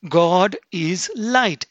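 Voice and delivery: very slow and weighty; slow emphasis. Acted. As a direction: let every word land.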